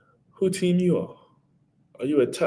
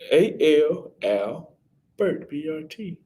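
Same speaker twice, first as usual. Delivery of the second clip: spelling out slowly; 5 semitones higher, much slower than usual. Shown as a demonstration. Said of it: "Spell Albert".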